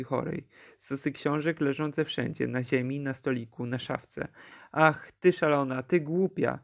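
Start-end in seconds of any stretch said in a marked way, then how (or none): none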